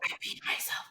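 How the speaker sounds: whispered confession. Retold or imitated. Client whispering to her waxer that she peed. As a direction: breathe it out with no voice. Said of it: "but you whispering".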